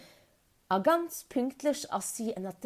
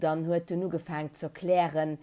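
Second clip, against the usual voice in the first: ordinary delivery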